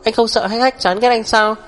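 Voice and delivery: high-pitched, mildly tearful reproach; tearful accusation. A narrator's reading. Wife accusing him of choking their child, mildly tearful. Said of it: "vừa khóc, vừa nói đứt quãng".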